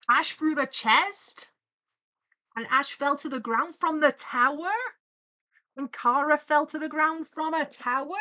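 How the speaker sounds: loud, high incredulous questions; shocked disbelief. Real, not acted. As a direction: read it out aghast, pitch rising. Shocked by the story read.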